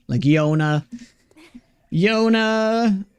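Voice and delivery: "Yona" drawn out, starting with a Y sound; Swedish J-as-Y. Retold or imitated. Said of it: "He pronounces J's like Y's".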